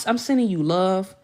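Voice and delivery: normal talking voice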